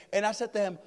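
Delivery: usual speaking voice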